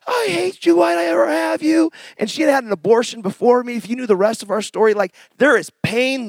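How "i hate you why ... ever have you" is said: mother screaming. Retold or imitated. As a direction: shriek it out as the furious mother. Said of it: "she's like".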